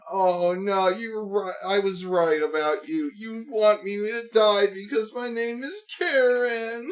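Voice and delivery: high, slow blubbering parody; fake-sob your way through it; mock fake crying. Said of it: "the Karen people do the same thing".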